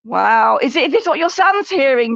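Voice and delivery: ordinary delivery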